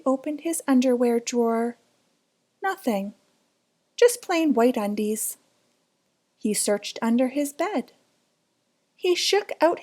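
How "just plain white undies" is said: plain reading voice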